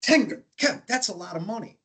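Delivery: high, pained hesitation over the price; mock a rich man squirming at a bill; squirming billionaire. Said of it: "I never saw a billionaire squirm so much".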